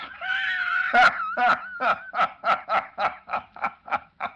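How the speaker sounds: high, loud cackle breaking into a shout; villain's laugh. Acted. Doctor Bly laughs wildly over Lois's photo, evil cackle. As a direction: throw back your head and crow with evil glee.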